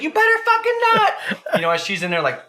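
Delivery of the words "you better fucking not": very high mock shriek; wife's outraged warning. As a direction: imitate an outraged woman, way up high. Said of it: "And she's like".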